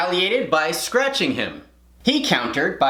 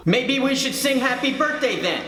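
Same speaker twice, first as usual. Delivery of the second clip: high put-on teacher voice; teacher imitation; 8 semitones higher than usual. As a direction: imitate a teacher questioning a pupil. Teacher replies, acted.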